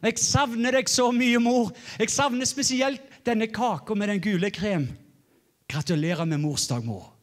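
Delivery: cheerful Norwegian son calling home; Norwegian phone call. Retold or imitated. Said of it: "I called Norway and said".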